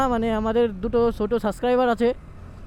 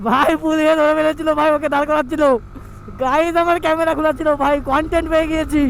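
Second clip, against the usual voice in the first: buzzing with excitement, pitch up; excited; 5 semitones higher, 4 dB louder than usual. Real, not acted. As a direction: blurt it out, thrilled. Police stopped friend, got content.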